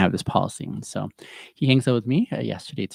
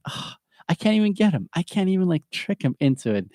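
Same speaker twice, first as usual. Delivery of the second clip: high, playful mock-frustrated groan; mock frustration; 8 semitones higher than usual. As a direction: fake annoyance that you can't catch your son. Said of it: "And I'm like".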